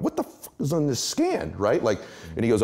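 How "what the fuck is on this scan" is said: a much higher yelp of sudden alarm; shocked alarm. Retold or imitated. Patient hearing grim news from his doctor, much higher shocked yelp. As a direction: retell the panic as the doctor's words land. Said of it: "I went".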